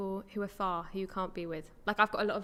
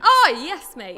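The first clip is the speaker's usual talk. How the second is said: much higher and louder burst; exclaiming; 7 semitones higher, 14 dB louder, much slower than usual. Acted. Reacting to a viewer's support, loud exclaiming. Mock reply about the pronunciation.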